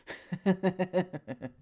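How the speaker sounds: high, loud forced laugh; demo laugh. Shown as a demonstration. Teacher shows students how to laugh into pain with a high forced laugh. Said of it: "It's like with me".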